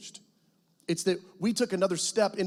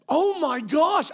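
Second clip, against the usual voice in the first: high, louder, slow words of amazement; awed exclamation; 11 semitones higher, 7 dB louder, much slower than usual. Retold or imitated. Missionary reading the student's red dream email, high, slow and amazed. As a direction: let the wonder land slowly.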